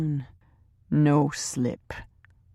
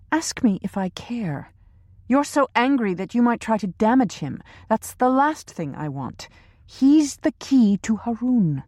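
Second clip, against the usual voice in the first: higher, imperious female voice; cold female boss; 7 semitones higher than usual. A narrator's reading. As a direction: demand it coldly from above. Teresa voiced by narrator.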